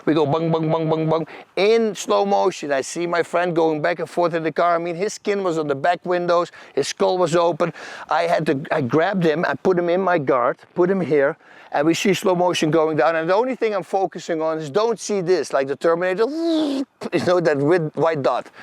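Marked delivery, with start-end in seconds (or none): 0.3-1.3 rapid repeated "bung" bumps; imitated crash thuds. Storyteller mimics car tumbling down the hill, imitated thuds. 16.3-17.1 high, quick electronic whirr; imitated Terminator sound. Storyteller mimics the Terminator focusing, imitated high whirr.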